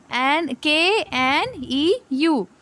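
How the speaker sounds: slow letter-by-letter spelling; spelling out slowly. Shown as a demonstration. Teacher spelling a word for students, slow and deliberate. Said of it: "इस वाले new की spelling क्या है?".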